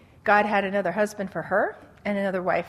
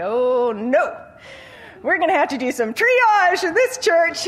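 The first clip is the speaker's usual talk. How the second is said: higher, louder, playfully alarmed; mock alarm; 7 semitones higher, 5 dB louder than usual. Pastor jokes about the handsome newcomer, mock panic. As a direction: sound the comic alarm bells. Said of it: "I was like".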